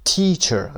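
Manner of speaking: "Teacher" with TEA pitched high; stress on first syllable. Shown as a demonstration. Teacher shows students where "Teacher" is stressed, on TEA. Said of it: "قسمت اول".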